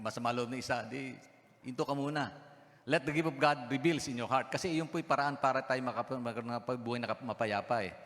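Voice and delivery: ordinary delivery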